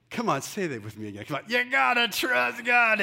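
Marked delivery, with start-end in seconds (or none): 1.5-3.0 high, loud, slow preacher impression; mock pulpit shout, words stretched; raspy preacher. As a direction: rasp it out like a revival preacher. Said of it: "In that raspy preacher voice".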